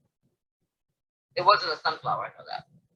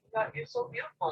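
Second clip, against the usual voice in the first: put-on much higher, soft child compliment; little girl voice; 10 dB quieter than usual. Little girl runs up to compliment them, high and soft. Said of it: "was like".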